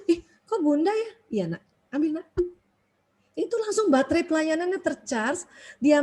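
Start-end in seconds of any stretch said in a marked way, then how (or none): 0.1-1.1 put-on surprised child. 1.3-2.3 gentle mum. Retold or imitated. Mother gently offering water.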